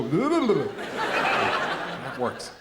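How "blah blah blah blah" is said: high, loud nonsense babble; sheep-worrying noise. Acted. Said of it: "I just go".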